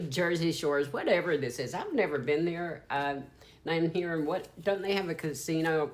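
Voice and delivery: ordinary talk, nothing marked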